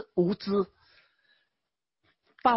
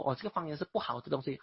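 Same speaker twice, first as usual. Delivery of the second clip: dismissive quote; 6 semitones lower, 6 dB quieter, much faster than usual. Retold or imitated. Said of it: "你说".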